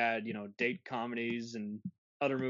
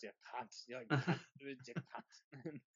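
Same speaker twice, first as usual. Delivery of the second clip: mock Scottish mumble; 9 dB quieter than usual.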